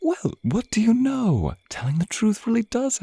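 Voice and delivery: high, whispered, playful surprise; mock-amazed breathy tease; amused whisper. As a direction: feign delight at the confession. Amused by the captive's honesty.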